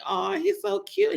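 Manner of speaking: high, cooing "Aw, he's so cute". Retold or imitated. Mother imitating guests cooing over her son, high. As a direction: coo it like a fond wedding guest. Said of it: "They're just gonna say".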